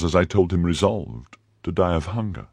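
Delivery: plain reading voice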